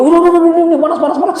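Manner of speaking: a spirit crying out that it burns; frantic high cries of the possessed; possessed woman raging. Retold or imitated. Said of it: "Ngamuk lagi dia".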